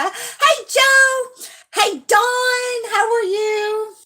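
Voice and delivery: high, drawn-out singsong hello; singsong greeting. Real, not acted. Streamer greeting viewers as they join, high singsong.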